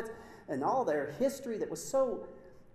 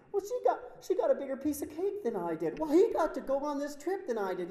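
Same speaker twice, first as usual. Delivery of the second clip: lightly voiced whiny child, higher; petty whining grievance; 8 semitones higher, 4 dB louder than usual. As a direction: mimic a child's petty complaint. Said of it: "little children".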